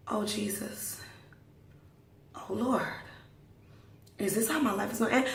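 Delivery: soft, resigned plea to heaven; hushed dread. Retold or imitated. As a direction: murmur it like a private prayer. Passenger's hushed dread, retold.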